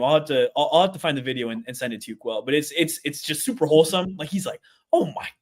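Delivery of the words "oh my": high-pitched British reaction, imitated; put-on British gasp of wonder; British accent. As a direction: imitate an astonished British football newcomer. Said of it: "I've never heard you do a British accent".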